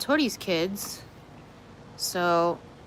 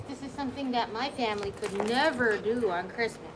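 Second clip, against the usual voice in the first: ordinary talk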